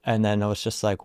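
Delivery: usual speaking voice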